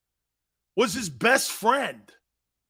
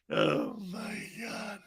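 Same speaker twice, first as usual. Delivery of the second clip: spent from laughing; 2 semitones higher, 9 dB quieter, much slower than usual. Real, not acted. Recovering from laughing.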